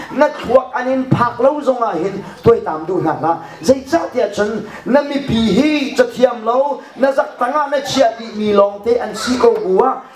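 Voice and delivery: usual speaking voice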